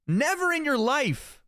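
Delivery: never in a million years, stretched high; high, slow dismissive refusal. Real, not acted. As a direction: drag it out, high and appalled. Rejecting a fan message.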